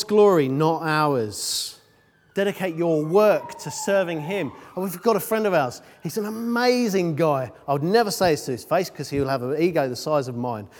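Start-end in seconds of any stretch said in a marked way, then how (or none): none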